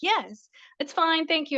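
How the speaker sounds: smooth it over so nobody looks closer; forced politeness. Retold or imitated. Brushing off the checkout lady.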